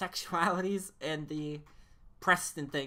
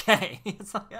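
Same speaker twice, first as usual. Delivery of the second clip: 1 laughing burst; 5 semitones higher, 13 dB louder, slower than usual. Real, not acted. Laughing at own question.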